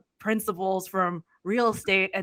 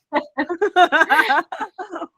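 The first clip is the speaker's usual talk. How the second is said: laughing; 10 semitones higher, 6 dB louder than usual. Real, not acted. Funny discoveries.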